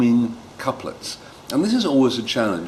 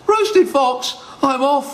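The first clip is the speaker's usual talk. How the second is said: much higher, louder panicked yelp; panicked fox; 4 dB louder, slower than usual. Acted. The fox panics at the mouse's answer, high frightened yelp. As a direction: shriek and bolt. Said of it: "fox panics and flees".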